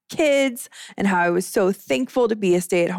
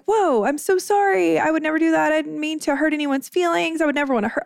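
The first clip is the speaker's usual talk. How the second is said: imitating her old self, much higher and eager to please; over-apologetic; 8 semitones higher than usual. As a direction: gush apologies in a high, anxious voice. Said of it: "I was just so apologetic".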